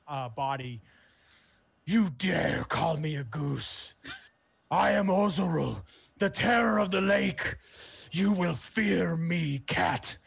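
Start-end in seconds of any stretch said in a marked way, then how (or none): 1.8-10.2 slow, loud villain proclamation; menacing monster. Acted. Smoky blue creature threatening the cat who taunted it, booming. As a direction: boom it out as an outraged, grandiose beast.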